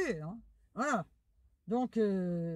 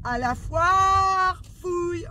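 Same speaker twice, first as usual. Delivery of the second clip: slow, drawn-out, louder announcement; excited announcement; 6 semitones higher, 8 dB louder, much slower than usual. Real, not acted. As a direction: stretch it out like a fanfare.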